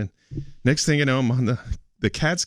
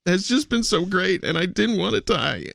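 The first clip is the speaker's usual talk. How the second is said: fighting back tears while thanking everyone; crying; 9 semitones higher than usual. Real, not acted. Thanking family and friends for saving him, tearful. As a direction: voice pitched high and breaking with tears. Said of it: "I'm sorry I'm crying right now".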